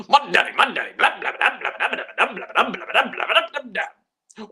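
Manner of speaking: high-pitched nonsense mockery; mocking babble. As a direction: babble it high, like mindless chatter. Mocking babble about daddy.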